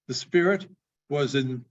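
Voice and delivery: ordinary delivery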